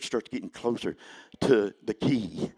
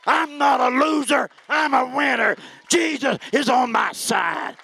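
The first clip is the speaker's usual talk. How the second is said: high, loud, building sermon shouts; full-force preaching; 9 semitones higher, 6 dB louder than usual. Acted. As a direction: preach it hard and loud to the congregation.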